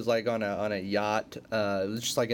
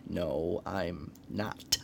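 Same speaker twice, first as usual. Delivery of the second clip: low, quiet villain imitation; hushed, low, persistent denial; Joker; 6 semitones lower, 7 dB quieter, much slower than usual. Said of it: "and he's like".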